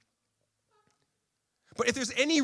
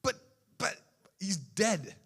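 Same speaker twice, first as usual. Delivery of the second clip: mock bewildered women, rising; puzzled disbelief; 2 semitones higher, slower than usual. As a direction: stammer in hammed-up confusion. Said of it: "It's like".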